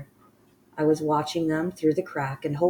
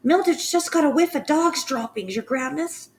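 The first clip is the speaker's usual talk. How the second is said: high, raised call up to the platform; calling back; 13 semitones higher, 5 dB louder than usual. Acted. Witch calls up to the Grand High Witch, high and loud. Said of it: "someone called back to her".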